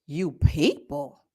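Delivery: low, slow, stunned question rising at the end; incredulous echo. Real, not acted. Repeating the clerk's insult to listeners in stunned disbelief.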